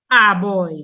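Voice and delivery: put-on "a boy" with an open ah for "a"; Granby pronunciation. Shown as a demonstration. Linguist shows village's way, Granby pronunciation. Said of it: "In Granby, we say".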